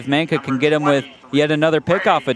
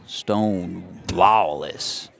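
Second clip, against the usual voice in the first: slow, low Western drawl; 5 semitones lower, 3 dB quieter, much slower than usual. Shown as a demonstration. Announcer playing up batter's name like a Western sheriff, slow drawl. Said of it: "say it with a bit of a drawl".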